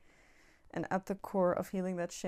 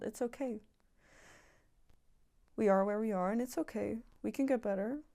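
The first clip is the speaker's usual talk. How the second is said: gentle reassurance; 3 semitones higher than usual. Real, not acted. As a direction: soothe them like a friend in pain. Soothing reassurance to ashamed viewer.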